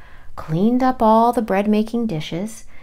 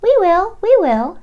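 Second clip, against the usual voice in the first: high, loud peeping chick voices; baby chick voices; 12 semitones higher, 9 dB louder than usual. Acted. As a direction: squeak it out like tiny chicks. Eager baby chicks answer.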